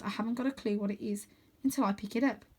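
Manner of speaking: plain reading voice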